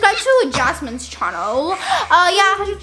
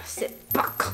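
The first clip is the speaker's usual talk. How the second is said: hushed whisper scolding; 7 dB quieter, slower than usual. Real, not acted. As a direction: whisper it sharply.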